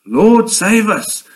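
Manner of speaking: a lightly voiced frightened plea; mild shout. A narrator's reading. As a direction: disciples cry out to Jesus in the storm, mild shout. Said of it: "they shouted".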